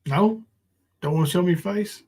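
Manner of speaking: normal talking voice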